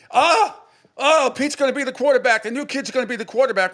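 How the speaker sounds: high, loud excited mock cheering; excited kids' clamor. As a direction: imitate a crowd of amazed kids crying out. Acting out impressed kids' reaction.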